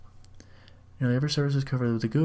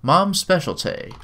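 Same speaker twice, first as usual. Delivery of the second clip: slow, louder machine announcement; robot voice; 5 semitones higher, 5 dB louder, slower than usual. A narrator's reading. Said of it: "says robotic voice".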